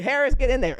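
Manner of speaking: loud coach calling a player in; coach's shout. Retold or imitated. Coach yelling at Harris to go in. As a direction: bark it from the sideline.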